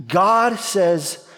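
ordinary talk

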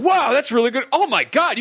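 high, impressed cop reaction; imitated amazement. Cops crowd around the phone video, imitated amazement. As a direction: marvel like an easily wowed crowd. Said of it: "surprised by this".

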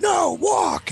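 high-pitched yelled command; hammed-up angry refusal; mock dad's shout. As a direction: imitate dad barking an order. Dad shouting orders at child.

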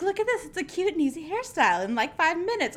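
high, quick hair-tutorial imitation; imitated video girl. Girl selling an easy hairstyle, high mock-perky voice. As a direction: pitch it high and chirpy, mimicking her. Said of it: "She's like".

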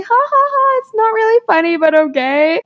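high-pitched, fake little laugh trailing into reluctant agreement; forced polite laugh. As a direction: humour him with an unconvincing high ha-ha. Acting out forced polite laugh at him.

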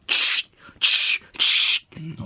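spaced hissy clicking noises; sharp repeated tongue-click effect. Acted. Mock storyteller cueing his big reveal, percussive clicks. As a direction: mouth a dramatic sound cue, slowly. Sound effect before the punchline.

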